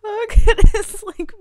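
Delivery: high loud slow squeal. Real, not acted. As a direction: squeal it out in delight. Gushing over villager.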